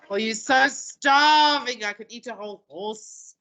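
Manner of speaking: hammed-up hunger, stretched out; dramatic teenager. Teacher mimicking exaggerating teenagers, mock-dramatic hunger. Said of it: "we love to be dramatic".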